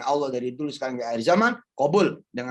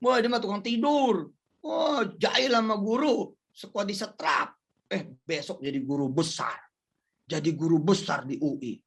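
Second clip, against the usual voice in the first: gossiping people; 7 semitones higher than usual. Retold or imitated. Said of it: "Kadang-kadang ngomongnya begitu".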